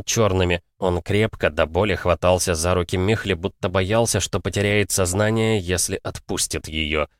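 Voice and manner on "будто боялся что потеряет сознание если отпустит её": ordinary delivery, nothing marked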